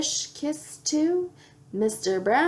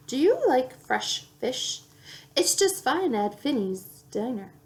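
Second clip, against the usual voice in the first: usual speaking voice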